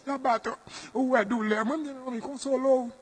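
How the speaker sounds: high-pitched wailing mourner; mock crying mother. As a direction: imitate a bereaved mother's high keening. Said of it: "e manman tonbe kriye".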